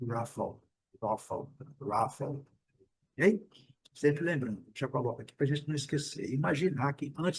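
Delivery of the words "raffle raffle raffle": slow "raffle" with lips rounded on the r. Shown as a demonstration. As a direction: purse your lips and draw out "raffle". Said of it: "E ainda fazer um biquinho". ("raffle" devagar, com biquinho no R)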